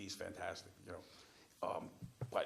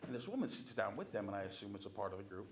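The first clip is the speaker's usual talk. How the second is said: usual speaking voice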